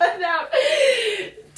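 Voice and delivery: high, drawn-out giggling echo; teasing laughing echo. Real, not acted. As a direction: stretch it out mid-laugh. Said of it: "laughing repeat".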